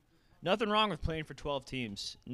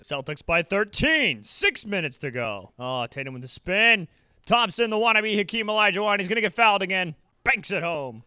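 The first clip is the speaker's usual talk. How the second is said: mock play-by-play; 6 semitones higher, 8 dB louder than usual. Play-by-play call.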